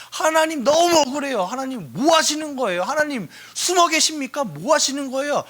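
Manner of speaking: high, pleading outcry; crying out to God. Acted. A wronged believer crying out to God, high and pleading. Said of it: "소리 지를 수 있어요".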